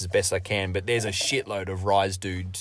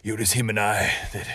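bad American accent; 4 dB quieter than usual. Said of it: "It's just a bad accent".

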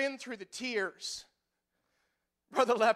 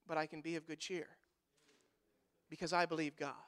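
quiet reassurance; 7 semitones lower, 12 dB quieter than usual. Real, not acted. Soft tender assurance after joke.

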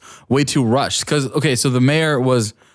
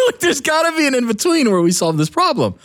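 laughing; 12 semitones higher than usual. Real, not acted. Laughing while ranting.

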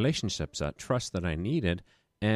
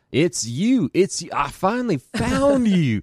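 excited caller in a much higher, louder Louisiana drawl; Louisiana accent; 12 semitones higher, 10 dB louder, slower than usual. Retold or imitated. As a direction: play the overjoyed Louisiana caller, slow and high. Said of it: "He had this Louisiana accent".

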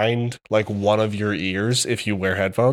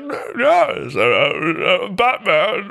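high stammering mock Bane voice; a hammed-up stuttering villain, slow; dinosaur voice; 9 semitones higher, much slower than usual. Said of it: "And he's like".

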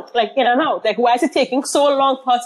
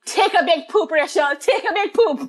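quoting friend; 7 semitones higher than usual. Retold or imitated. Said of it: "you told me".

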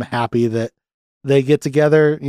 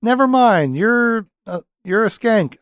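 mock Jack voice; 11 semitones higher than usual. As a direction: snap the insult in a high voice. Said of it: "And Jack goes".

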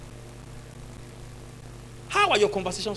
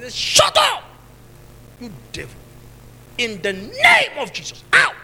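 acted exorcism shout; 6 semitones higher, 7 dB louder, slower than usual. Pastor casting out a demon by phone, shouted rebuke. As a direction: bellow the commands, stretching the middle slowly.

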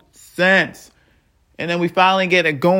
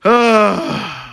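anguished sigh; 4 semitones higher, 4 dB louder than usual. Real, not acted. Overwhelmed by the loss.

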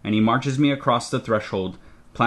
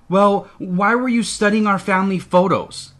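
growl; 10 semitones higher, 5 dB louder than usual. Acted. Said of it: "I ask with a growl".

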